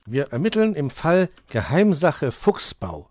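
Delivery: high, loud, important announcement; Borstel proclaiming. A narrator's reading. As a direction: proclaim the secret case proudly. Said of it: "verkündete Borstel".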